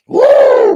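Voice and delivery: loud high whoop at the end of a rant. Real, not acted. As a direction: let out a big wild whoop. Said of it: "When I'm ranting and fucking raving".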